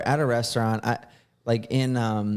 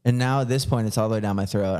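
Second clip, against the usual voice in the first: normal talking voice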